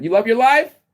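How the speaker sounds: high, loud shouted question; rousing shout across the street; calling out. Acted. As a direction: holler it to the neighbors. Calling out loudly to neighbors.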